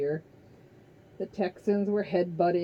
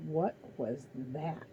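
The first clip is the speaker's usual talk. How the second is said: much softer and slow; nervous hush; 3 semitones lower, 7 dB quieter, slower than usual. A narrator's reading. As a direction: ask it under your breath, uneasy. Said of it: "looking nervous".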